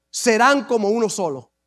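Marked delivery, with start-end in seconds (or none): 1.1-1.5 hushed. Real, not acted. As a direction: drop to a near whisper on the last word. Preacher drops to hush.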